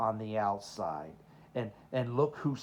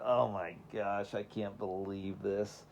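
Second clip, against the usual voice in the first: slow, soft, glum disbelief; dismayed kid; 3 semitones lower, 4 dB quieter than usual. Retold or imitated. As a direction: let it sag out slowly like a stunned kid. Kid's dismay quoted again.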